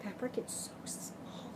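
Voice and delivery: soft unvoiced whisper. Real, not acted. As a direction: whisper it to yourself. Whispered aside about tiny stitches.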